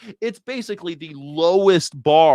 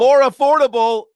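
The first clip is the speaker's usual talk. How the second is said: high, loud disbelieving echo; mocking incredulous echo; 9 semitones higher, 9 dB louder than usual. Real, not acted. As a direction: scoff back her words in disbelief.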